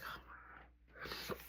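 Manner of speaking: breathless whisper under the ringing; hushed anxious whisper; nervous whisper. Retold or imitated. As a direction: barely voice it, nervous. Nervous wait as phone rings.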